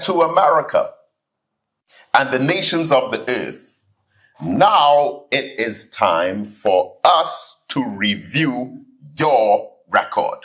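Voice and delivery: slow, weighty quotation; quoting a preacher. Retold or imitated. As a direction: deliver the solemn warning slowly. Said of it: "he said".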